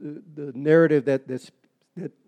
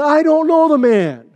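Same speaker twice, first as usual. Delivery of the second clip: loud, much higher cursed denial, I don't know the man; angry denial; 12 semitones higher, 11 dB louder than usual. Retold or imitated. Peter denying Jesus to the maiden, loud and higher. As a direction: shout it like a cornered man lashing out.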